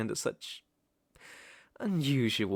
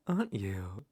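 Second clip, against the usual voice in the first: ordinary talk, nothing marked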